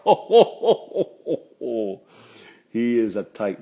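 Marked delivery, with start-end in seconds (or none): 0.0-2.0 high, loud ho ho laughing that fades away; knowing laugh. Real, not acted. Laughing about how cheap his father is. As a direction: laugh it off at dad's stinginess.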